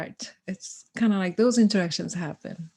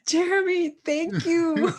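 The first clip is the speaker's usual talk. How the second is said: high, loud, heartfelt thanks; moved thanks; 10 semitones higher, 4 dB louder, slower than usual. Retold or imitated. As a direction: thank him, overcome with emotion. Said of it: "I was just so moved".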